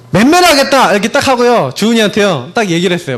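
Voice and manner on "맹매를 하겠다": higher, firm declaration of punishment; final notice. Retold or imitated. Father gives final notice to child who won't eat, emphatic. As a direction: announce the punishment emphatically, pitch raised. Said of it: "마지막으로 통보했어요".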